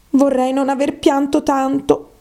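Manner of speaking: character voice with mild regret; regretful Alice. Acted.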